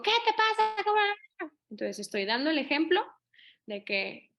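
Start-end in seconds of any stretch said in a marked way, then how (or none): 0.0-1.5 high, slow mock outburst; angry snap. Parent snapping at the line-cutter, mock angry. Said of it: "exploté y le dije".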